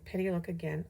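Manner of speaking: usual speaking voice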